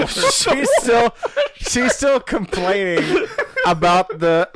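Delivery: breaking into laughter mid-sentence; giggling through the words, slow; laughing. Real, not acted. Telling friends about the actress while laughing.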